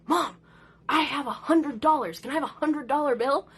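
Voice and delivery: mock excited pleading; eager kid. Son eagerly asking for a bill.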